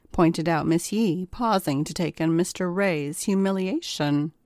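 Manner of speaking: ordinary delivery, nothing marked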